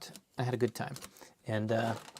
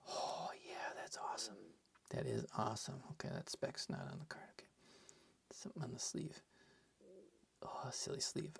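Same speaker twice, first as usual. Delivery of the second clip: much softer murmured aside; whispering; 5 semitones lower, 21 dB quieter, faster than usual. Real, not acted. Streamer whispers to self while sleeving the card, whispering.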